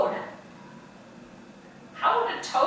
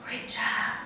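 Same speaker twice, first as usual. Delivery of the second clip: soft whispered "Great job"; whispered praise; 9 dB quieter than usual. Real, not acted. Teacher praising the cat moves, whispered "Great job". Praising kids during movement game.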